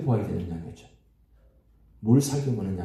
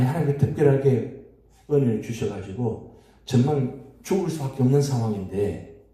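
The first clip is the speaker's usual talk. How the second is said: normal talking voice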